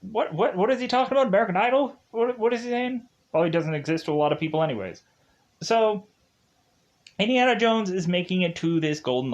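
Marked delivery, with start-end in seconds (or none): 0.0-3.1 much higher, baffled voice; confused future viewer. Retold or imitated. Future viewer baffled by the joke, high and puzzled. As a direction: play someone who never heard of American Idol. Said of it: "it'll like".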